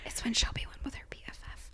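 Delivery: fast, unvoiced whisper. Real, not acted. Whispering a teasing aside to her co-host, hushed.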